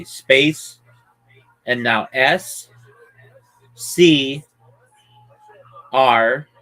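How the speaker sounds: spelling it out. Shown as a demonstration. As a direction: spell each letter clearly and slowly. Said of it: "I'm spelling it for you".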